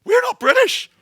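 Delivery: high desperate shout; imagined shout. Retold or imitated. Passenger pleading with the Iranian pilots, shouting high. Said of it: "I wanna shout to him".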